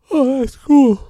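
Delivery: high, loud delighted surprise; surprised. Retold or imitated. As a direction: burst out in happy surprise. Retold reaction to kindness.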